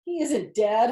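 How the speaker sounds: loud, high holler. Acted. Zuckerman hollering back to the loudspeaker, loud. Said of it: "hollered Zuckerman".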